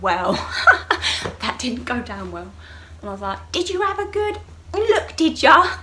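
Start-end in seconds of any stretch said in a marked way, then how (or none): 0.4-1.3 real high, loud laugh; burst of laughter. Real, not acted. Laughs at her own story. 3.5-5.8 high, loud sarcastic retort; indignant challenge. Retold or imitated. Patient to doctor who looked uninvited, loud indignant challenge.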